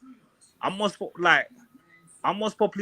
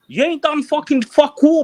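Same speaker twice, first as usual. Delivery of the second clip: angry outburst; 6 semitones higher, 5 dB louder than usual. Real, not acted. Heated argument over the net.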